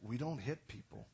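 soft, understated reproof; real calm. Retold or imitated. Pastor looking at his staffer, calmly correcting him. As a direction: state the rule quietly, unruffled. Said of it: "he just said real calmly".

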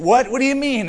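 put-on "What?" jumps up high, a startled child caught out; startled kid. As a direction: play the boy who can't believe mom knows.

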